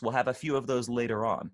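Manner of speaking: ordinary talk, nothing marked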